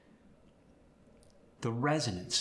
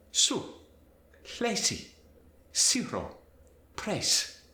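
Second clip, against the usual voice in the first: Spanish accent; 9 semitones higher, slower than usual. Shown as a demonstration. Teacher demonstrating Z to S, Spanish accent. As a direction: hiss every Z as an S. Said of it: "Z sound is gonna change to the S".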